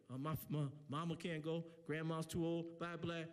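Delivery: low monotone mock excuse; kid's excuses. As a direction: drone it like a resigned kid.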